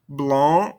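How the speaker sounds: "Blonde" rising, cut before N and D; silent ending consonants. Shown as a demonstration. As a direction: end "Blonde" on the nasal vowel. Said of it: "don't pronounce these ending consonants".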